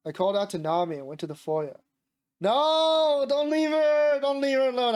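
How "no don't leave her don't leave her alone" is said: high, loud drawn-out wail; wailing protest. Real, not acted. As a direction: cry out in playful dismay, easing off by the end. Player protests at the story's choice.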